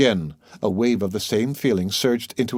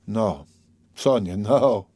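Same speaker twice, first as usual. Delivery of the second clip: usual speaking voice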